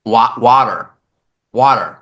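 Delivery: "water" with a rounded "aw", pitched low; New Yorker's "water". Shown as a demonstration. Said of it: "the word water is hard for me to say".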